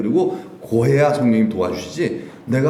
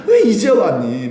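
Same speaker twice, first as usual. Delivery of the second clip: slow, high, louder glad welcome; father's welcome; 8 semitones higher, 5 dB louder, slower than usual. Acted. Father welcomes the prodigal home, slow and glad. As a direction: draw it out like a father overjoyed. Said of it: "막 그러시잖아요".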